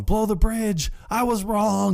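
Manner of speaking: high-pitched yell without full volume; hammed-up distant yell; mock yelling. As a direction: imitate someone shouting a desperate order. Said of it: "So he's yelling".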